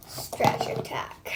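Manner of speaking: slow, drawn-out odd whisper of stretch attack; goofy slowed stretch attack; weird delivery. Real, not acted. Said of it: "Why did I say that so weird?".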